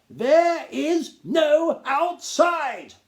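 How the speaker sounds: high, loud, self-important Duke lecturing; a pompous gnome lord talking down to the boy. Acted. Duke's pompous speech.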